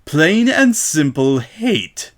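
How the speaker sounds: slow, tight, forced out; words through teeth. A narrator's reading. Shop owner spelling it out to Rainbow, slow and tight. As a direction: chew the words slowly in contained anger. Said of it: "worried between her teeth".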